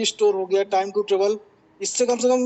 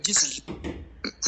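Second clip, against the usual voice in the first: faint, near-whispered reply; barely audible; 6 dB quieter, much slower than usual. Real, not acted. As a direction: voice barely coming through on the call. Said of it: "भाई आपकी आवाज कम आ रही है".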